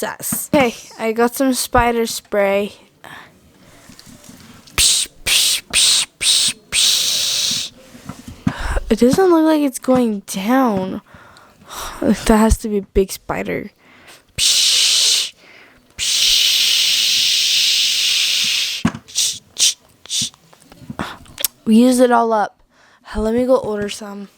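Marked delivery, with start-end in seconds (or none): 4.7-7.7 unvoiced psh spray noises; spray hiss sound. 14.4-20.3 unvoiced psh spray noises; spray hiss sound.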